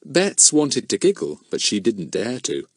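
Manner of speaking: usual speaking voice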